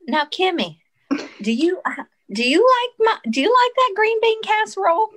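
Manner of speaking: hammed-up Southern mom cooing at her daughter; imitating Mom. Sister imitates Mom asking about casserole, high sweet mock voice. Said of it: "she was like".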